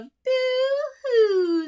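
high boo-hoo falling down; high to low. Shown as a demonstration. Teacher repeating the rat's boo-hoo as a downward melody. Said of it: "we've got that down".

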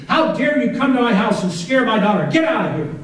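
high, outraged yell, held a little in check; acted scream. Son screaming at his mother on the doorstep, angry. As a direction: order an intruder out, pitched well up. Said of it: "I screamed at you".

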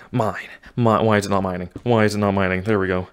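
ordinary talk, nothing marked

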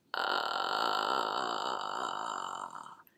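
very low creaky ah; vocal fry. Shown as a demonstration. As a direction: let the folds flap loosely. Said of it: "バタバタバタと震えるとこんな音".

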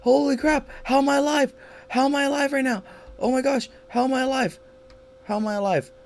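very high, loud disbelieving shrieks; shrieking panic. Real, not acted. Gamer shrieking after surviving a deadly chase. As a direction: shriek like you barely escaped death. Narrow escape in the game.

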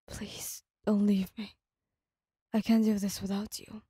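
hushed, breaking plea; choked pleading. A narrator's reading. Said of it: "his voice choked with emotion".

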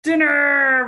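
loud, high-pitched storybook roar; bear's roar. A narrator's reading. The bear spots Dot and Wolfie, roaring. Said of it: "roared the bear".

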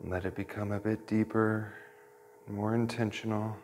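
soft, hushed meditation voice, whispered at the start; guided meditation. Acted. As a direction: gently guide the class into their breath.

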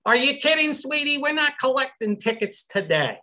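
very high, exaggerated New York accent; hammed-up New York ticket lady, incredulous. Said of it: "in her finest New York accent".

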